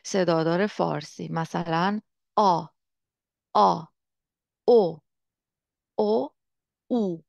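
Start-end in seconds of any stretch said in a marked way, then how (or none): 2.4-3.8 آ from the back of the mouth; isolated آ, said clearly like Persian ā; back vowel. Shown as a demonstration. 4.7-7.2 او from the back of the mouth; isolated, clearly spaced Persian-like او; back vowels. Shown as a demonstration.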